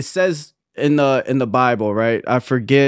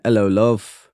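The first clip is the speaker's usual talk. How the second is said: lower-pitched mock British greeting; low, hammed-up British accent; put-on British accent; 4 semitones lower than usual. Shown as a demonstration. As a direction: play at a British accent, dropping the H. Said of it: "That's my accent".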